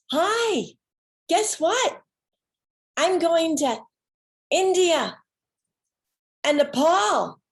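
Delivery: high and loud, bubbling over; excited announcement. Retold or imitated. Daughter phoning her parents, bubbling with excitement. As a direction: burst out the news to your parents.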